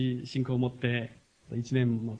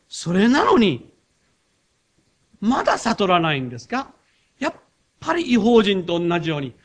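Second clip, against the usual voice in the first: raised, loud rebuking sermon voice; forceful preaching; 6 semitones higher, 7 dB louder than usual. Acted. Pastor voicing Jesus rebuking the disciples, loud and forceful.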